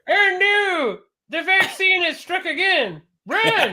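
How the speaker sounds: very high, loud cartoonish alarm; mock panic. Mock alarm about the vaccine.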